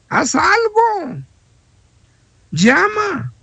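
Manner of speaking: high, slow, insistent "Haz algo"; urgent plea. Real, not acted. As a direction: stretch it out and push them to act. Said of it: "urging listeners to act". (súplica urgente y aguda)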